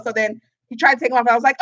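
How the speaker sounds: ordinary delivery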